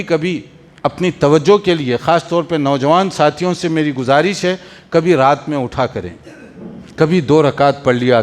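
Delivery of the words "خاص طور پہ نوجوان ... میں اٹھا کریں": ordinary talk, nothing marked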